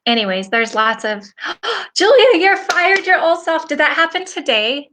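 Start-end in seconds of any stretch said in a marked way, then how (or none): 1.3-1.9 sharp high gasp of delight; excited gasp. Real, not acted. Host gasps at a viewer's comment. 2.0-4.9 high and loud, thrilled; excited. Real, not acted. Host cheers a viewer who fired her old self, excited.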